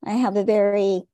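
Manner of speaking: normal talking voice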